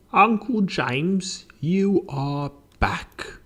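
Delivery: high, very slow, drawn-out voice; confused professor. Acted. Professor mistaking visitor for his uncle, high and slow.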